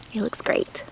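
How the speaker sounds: soft unvoiced whisper. Real, not acted. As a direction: whisper it to the little cucumber. Whispered aside to cucumber.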